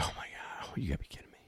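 hushed, breathless whisper of disbelief; tense whisper to yourself. Retold or imitated. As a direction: whisper it under your breath, dreading the worst. Tense moment, whispered to self.

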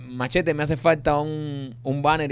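pidiendo despacio. Retold or imitated. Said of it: "y tú le dices a Machete".

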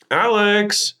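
"Alex" high and drawn out; wife calling his name. Acted. Wife calls her husband on the phone, high drawn-out tone. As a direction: sing out "Alex", held high and long. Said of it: "The way that she says my name".

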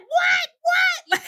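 very high, startled double take; pitched-way-up incredulous yelp; shocked disbelief. Retold or imitated. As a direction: cry it out in stunned surprise. Mom reacting to the news.

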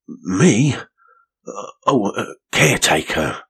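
a startled little man, caught out; rough caretaker. A narrator's reading. Caretaker figure voiced.

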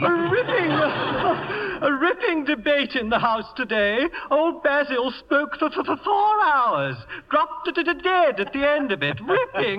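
stammering, high-pitched hearty toff; Lord Bottomley impression. [name] keeps mimicking Lord Bottomley's speech, stammering toff. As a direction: bluster like a pompous old peer. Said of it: "No one sounds like that".

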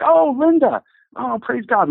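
high gasp of surprise into praise; astonished recognition. Retold or imitated. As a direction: recognize her with a jolt of joy. Recognizing the healed woman.

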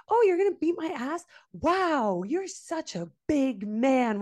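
taunting sarcasm at the angry driver; sarcastic mock yell. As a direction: scoff at his threat. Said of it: "screaming my head off".

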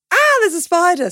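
high, loud little shriek; mock scared shriek at a spider; mock spider fright. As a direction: jump at a spider, half in jest. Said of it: "trying not to be like".

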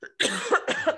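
loud rough cough; coughing fit. Real, not acted. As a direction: cough hard. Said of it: "coughing while I'm talking".

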